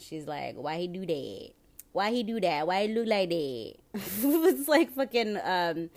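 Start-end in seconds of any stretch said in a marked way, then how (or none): 0.5-3.7 a mock Detroit accent; imitating movie character's accent. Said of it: "she has that accent". 3.9-5.4 laughing. Real, not acted. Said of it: "laughing while talking".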